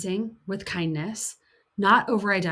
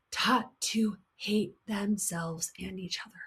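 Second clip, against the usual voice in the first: hushed, breathless whisper; 8 dB quieter than usual. Real, not acted. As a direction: drop to a whisper on the hurt. Heartbroken aside on women.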